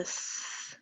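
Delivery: "S" as a sustained unvoiced hiss; S sound. Shown as a demonstration. Teacher modelling the last sound of instruments, hissed "S". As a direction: make "S" the sound, not the letter name. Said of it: "You hear the S sound".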